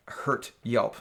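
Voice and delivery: usual speaking voice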